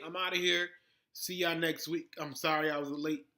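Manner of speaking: ordinary talk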